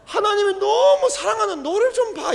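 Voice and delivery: high, tender "너를 좀 봐"; tender, not scolding. Shown as a demonstration. Pastor showing the congregation how to say it tenderly, not scolding. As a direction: say it lovingly, not as a rebuke. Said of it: "꾸짖듯이 하는 게 아니라".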